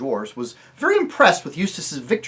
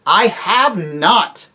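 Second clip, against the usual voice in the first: loud, very slow, higher denial; angry; 7 semitones higher, 10 dB louder, much slower than usual. Acted. Tirian denies the dwarfs' charge angrily. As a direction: bite off each word in anger. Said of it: "said Tirian angrily".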